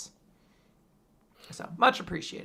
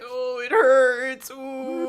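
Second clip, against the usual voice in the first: loud, higher, slow whiny moan; mock moaning heads; 6 semitones higher, 5 dB louder, much slower than usual. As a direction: whimper it out slowly like a pitiful head. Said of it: "And they're all like".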